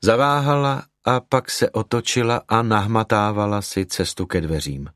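plain reading voice

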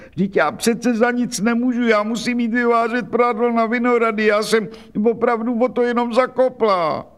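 put-on a frightened old laundress begging; anxious washerwoman. Old washerwoman pleading in panic.

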